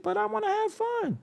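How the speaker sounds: high, soft playful sing-song; squeaky playful aside. Real, not acted. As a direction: tease it out lightly.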